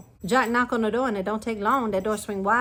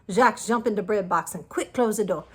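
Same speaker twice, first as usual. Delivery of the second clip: ordinary delivery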